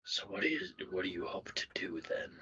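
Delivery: hushed question; whispering. Acted. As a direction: ask it under your breath.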